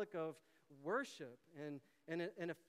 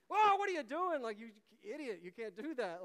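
alarmed shout; 5 semitones higher, 9 dB louder than usual. Retold or imitated. Crowd member scolds the volunteer.

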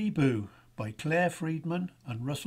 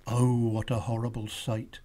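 ordinary delivery

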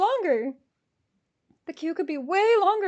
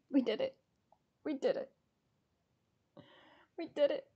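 quiet relief; 8 dB quieter, much faster than usual. Real, not acted. Ticket buyer after the order completes, quietly relieved. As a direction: murmur it, spent and relieved.

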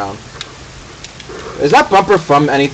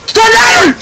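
excited shout; 4 dB louder than usual. Real, not acted. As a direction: shout it out high and loud. Yelling challenge in game.

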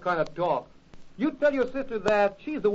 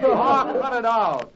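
laughing protest; 4 semitones higher, 6 dB louder, slower than usual. Acted. Spud laughs off the teasing.

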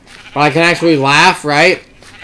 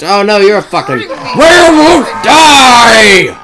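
panicked shout; 6 semitones higher, 5 dB louder, slower than usual. Real, not acted. Attacked by werewolf in game.

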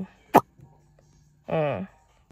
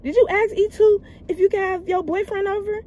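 high pitched, fast mocking yell at the top of her lungs; shrill yelling; 13 semitones higher than usual. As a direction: imitate the babysitter screaming at you. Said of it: "yelling at the top of her lungs".

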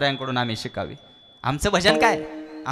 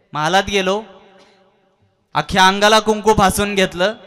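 loud, much higher, rising preacher's cry; impassioned preaching; 7 semitones higher, 12 dB louder than usual. Acted. Hanuman praying to the Lord of the world, loud high fervent cry. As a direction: build the prayer to a loud devoted climax.

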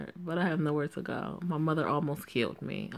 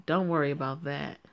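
ordinary talk

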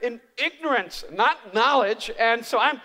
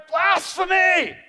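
a slow, drawn-out cry of Blasphemy, much louder and higher; mock outraged shout; 7 semitones higher, 7 dB louder, much slower than usual. The other disciples shouting Blasphemy at Peter. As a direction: ham up the disciples' horror.

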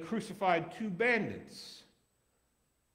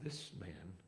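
hushed, much softer confession; awed; 7 semitones lower, 12 dB quieter than usual. Acted. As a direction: say it in quiet awe.

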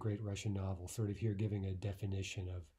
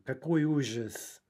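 slow, theatrical dismay; hammed-up horror, drawn out; mock horror; 3 semitones higher, 4 dB louder than usual. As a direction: play the tragedy slowly.

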